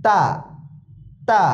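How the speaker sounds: তা light and thin, tongue tip at the teeth; touch the tongue tip to the upper front teeth for a light তা. Shown as a demonstration. Teacher separating two confused letters, thin light তা. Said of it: "হালকাভাবে মুখ খুলবে".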